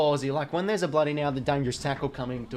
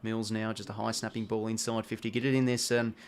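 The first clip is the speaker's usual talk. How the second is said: ordinary delivery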